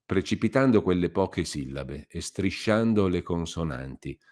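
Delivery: ordinary delivery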